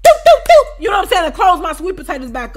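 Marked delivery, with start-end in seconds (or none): 0.0-0.7 high, loud sung doops; imitated doop. Describing her closing the pots, high loud doops. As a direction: pop out the lids closing.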